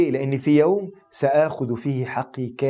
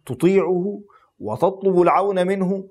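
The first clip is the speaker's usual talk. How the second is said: higher, slower preaching swell; climactic preaching; 7 semitones higher, slower than usual. Acted. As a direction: lift it to a slow climax.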